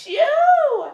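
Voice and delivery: very high, hammed-up protest; mock distressed cry. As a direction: act out a girl pleading to stop. Acting out girl stopping a fight.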